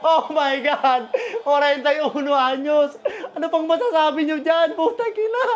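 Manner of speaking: slow, incredulous laughing exclamations; awestruck laughing. Real, not acted. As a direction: draw out the words in delighted disbelief. Marvels at veteran's big basket.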